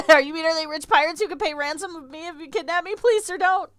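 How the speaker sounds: high, loud, hammed-up begging victim; mock pleading captive. Clerk mocking the pirate line, hammed-up pleading high voice. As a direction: squeal it like a panicked hostage. Jokingly plays a frightened kidnapped victim.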